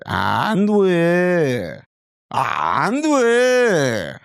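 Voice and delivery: high, sung "And where" falling down; Korean intonation. Shown as a demonstration. Tutor contrasting English and Korean intonation, high sung. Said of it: "Can you hear the difference becoming Korean?".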